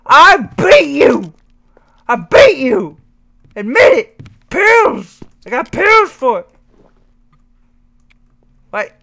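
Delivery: high, loud slow rage shouts; shaking with anger. Real, not acted. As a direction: scream in frantic fury. Rage rant at game.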